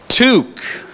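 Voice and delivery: high, drawn-out Canadian "Toque" with a strong T; Canadian accent. Shown as a demonstration. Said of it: "What is it?".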